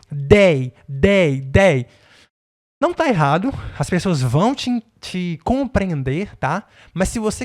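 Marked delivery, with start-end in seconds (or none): 0.1-1.9 "They" said with a hard D, like "dey". Shown as a demonstration. Teacher shows Brazilian students the school version, "They" with D. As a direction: pronounce "They" with a D instead of TH. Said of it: "a mesma pronúncia um D".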